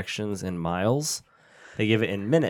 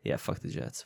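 mock muttered quote; 10 semitones lower, 11 dB quieter than usual. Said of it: "But you meant to say".

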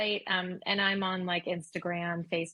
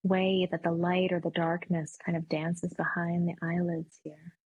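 meditation voice. Acted. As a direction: ease them gently back into the room. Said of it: "a great meditation voice too".

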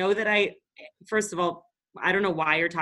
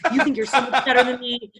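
higher mock-indignant quote, laughing; mocking imitation; 5 semitones higher, 4 dB louder than usual. Guest retelling the bar guy's jab, mockingly indignant. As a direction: mimic his grievance with a laugh underneath.

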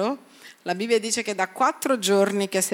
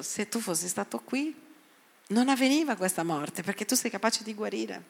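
bowed, soft reproach; 9 dB quieter than usual. Retold or imitated. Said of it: "seppure piegata".

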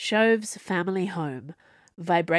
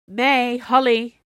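loud, slow call of two names; 5 semitones higher, 6 dB louder, slower than usual. Acted. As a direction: call them over loudly. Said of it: "Viola called".

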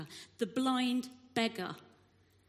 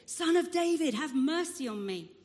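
high, raised shout, easing at the end; 5 semitones higher, 5 dB louder than usual. A narrator's reading. Blind man to Jesus despite rebukes, shouting. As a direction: shout the plea more insistently. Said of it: "he shouted all the more".

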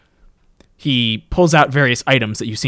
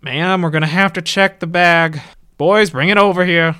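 raised, louder official voice; authoritative ticket man; 6 semitones higher, 5 dB louder than usual. Acted. Ticket man orders guards to bring the bag, raised official voice.

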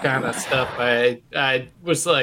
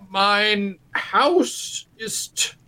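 very slow, laboured schoolboy German; halting German presentation; 4 semitones higher, much slower than usual. Retold or imitated. Bad student giving his German oral exam, painfully slow. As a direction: draw out each word as a clueless language student. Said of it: "oral presentations as well in, in German".

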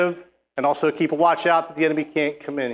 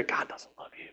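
breathy unvoiced whisper; 16 dB quieter than usual. The enemy whispering doubt into your ear, soft whisper. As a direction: hiss it softly like a tempter. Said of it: "whisper in your ear".

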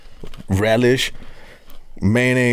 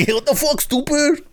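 much higher put-on insult; movie quote voice. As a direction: mimic a film character. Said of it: "repeats the movie line".

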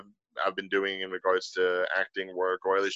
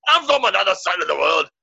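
loud, high, gravelly Scottish shout; angry Scottish accent; 9 semitones higher, 7 dB louder than usual. Acted. Tipsy man insisting where he's from, angry Scottish accent. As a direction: bellow it in a rough Scottish brogue. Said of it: "turning into Scottish because I'd be getting a little angry".

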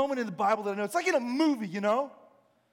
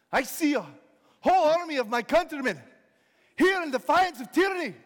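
high, loud battlefield oratory; rally the troops with a ringing call; William Wallace; 6 semitones higher, 5 dB louder than usual. Acted. Rider addressing his army before battle, rousing. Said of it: "William Wallace rides up on his horse".

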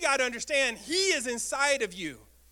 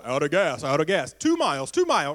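lower, louder mechanical car announcement; car warning voice; 9 semitones lower, 5 dB louder than usual. Retold or imitated. Pastor mimics a car warning the driver, robotic and low. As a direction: play the car nagging you. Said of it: "Some of your cars go".

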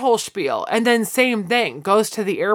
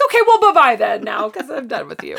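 dismissive brush-off; 4 semitones higher, 4 dB louder than usual. Retold or imitated. Imitating a snooty character dumping someone.

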